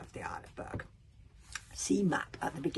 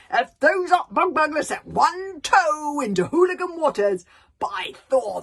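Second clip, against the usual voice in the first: furious roar; 8 semitones higher, 10 dB louder than usual. Acted. Stoic headbutting the wall, roaring at Hiccup. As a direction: bellow it while butting the wall. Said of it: "roared Stoic the Vast".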